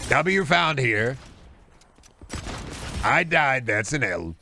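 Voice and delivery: absurd politician impression; Donald Trump. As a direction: announce nonsense with swooping, boastful emphasis. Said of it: "I do the Donald Trump voice".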